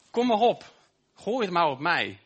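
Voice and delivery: high, loud call daring the blows; defiant cry of Jesus. Retold or imitated. Preacher voicing Jesus on the cross, loud defiant cry. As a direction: shout it out, inviting the weight onto you. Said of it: "Toen hij er hing".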